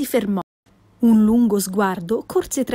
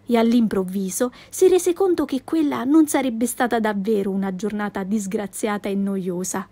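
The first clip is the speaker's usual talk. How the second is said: ordinary delivery, nothing marked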